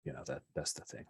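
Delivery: softly trailing, nervous explanation. Acted. Blue Orchid explains away the scary show, nervously. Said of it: "Blue, Blue Orchid nervously says".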